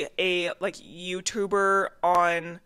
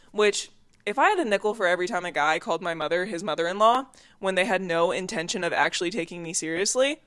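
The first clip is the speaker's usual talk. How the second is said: ordinary talk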